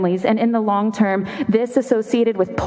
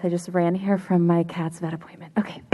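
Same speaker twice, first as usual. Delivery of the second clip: winded, whispered and soft; hushed, out of breath from running; breathless whisper; 4 semitones lower, 5 dB quieter than usual. Real, not acted. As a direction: gasp it out after running here. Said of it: "I'm a little out of breath".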